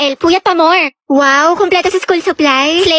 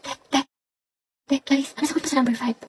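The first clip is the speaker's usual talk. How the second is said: soft, fast whisper to a classmate; test whisper; 2 semitones lower, 12 dB quieter, much faster than usual. Acted. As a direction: whisper quickly so the teacher won't hear. Copying answers during a test.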